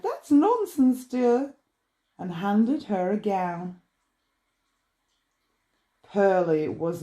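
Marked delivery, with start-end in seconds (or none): 0.0-1.5 much higher, brisk shop owner; shop lady, high and dismissive. A narrator's reading. Shop owner dismissing Pearlie, high and haughty. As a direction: brush off the girl's fuss, high and haughty. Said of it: "Francine said".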